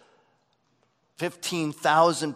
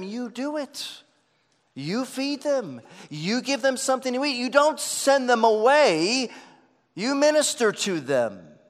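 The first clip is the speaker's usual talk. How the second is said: Jesus insisting; 7 semitones higher, 5 dB louder than usual. Acted. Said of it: "Jesus is saying to them".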